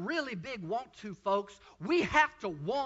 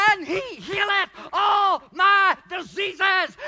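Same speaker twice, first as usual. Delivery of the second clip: high, loud, slow roaring praise; screaming praise; 8 semitones higher, 14 dB louder, slower than usual. Retold or imitated. Healed man laps the school screaming praise. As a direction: scream it to heaven at full force. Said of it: "I was screaming".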